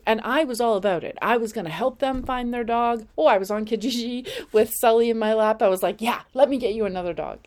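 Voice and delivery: bubbly fast retelling; excited. Real, not acted. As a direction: rush it out with excitement.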